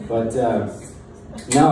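normal talking voice

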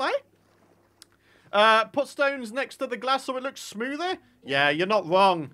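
usual speaking voice

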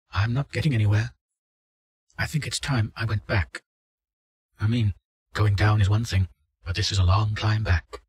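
worn out, wanting to turn back; tired frightened woman. Acted. Subject wants to quit.

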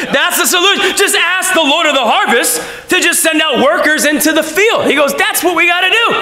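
high, laughing, near-shouted announcement; excited Jesus. Retold or imitated. Jesus telling disciples to pray for workers, excited. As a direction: proclaim it like great news. Jesus giving the solution, fired up.

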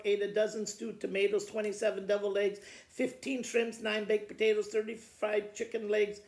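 ordinary delivery, nothing marked

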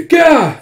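very high, loud "gah"; mock yelp on being hit; acted pain cry. Soldier shot with an arrow, acted yelp.

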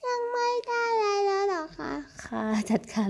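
high squeaky pet voice, as if the cat talks; cat's voice. Pretend. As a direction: play the cat, pitched well up. Owner voices her cat replying.

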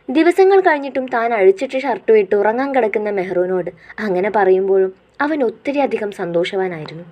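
ordinary talk, nothing marked

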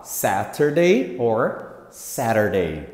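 Saturday slow, two versions; two pronunciations. Shown as a demonstration. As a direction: show both ways of Saturday. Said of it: "duas formas de falar". (Saturday das duas formas, devagar)

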